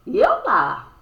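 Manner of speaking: high, loud astonished burst. Acted. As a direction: gasp it out, amazed. Astonished aside in story.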